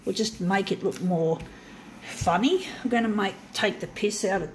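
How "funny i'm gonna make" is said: usual speaking voice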